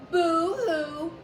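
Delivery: loud, sing-song mock crying; the bat mocking a crying ghost; mocking boo-hoo. A narrator's reading. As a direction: taunt him with fake sobs. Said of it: "the bat mocked".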